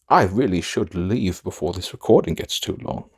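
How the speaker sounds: ordinary talk, nothing marked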